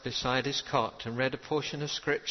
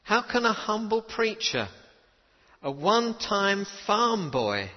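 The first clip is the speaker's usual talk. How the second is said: humble self-doubting chaplain; 8 semitones higher, 4 dB louder than usual. Retold or imitated. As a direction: ask it as a doubting farm-boy preacher would. Chaplain quoted doubting himself.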